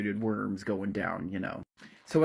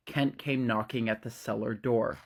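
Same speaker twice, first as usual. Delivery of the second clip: usual speaking voice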